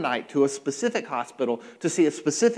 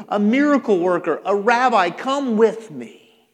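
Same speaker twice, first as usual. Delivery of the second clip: raised, insistent urging; confident big ask; 4 semitones higher, 5 dB louder than usual. Acted. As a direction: build from cajoling to a loud demand. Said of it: "He's confident, and he makes a big ask".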